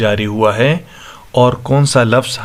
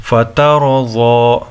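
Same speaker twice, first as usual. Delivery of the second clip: slow recited word with long final vowel; slow tajweed demonstration; Quran recitation; much slower than usual. Shown as a demonstration. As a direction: recite it drawn out and measured.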